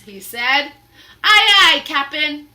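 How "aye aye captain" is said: loud eager aye aye; pirate crew reply. Acted. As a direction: answer the captain. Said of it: "they answered".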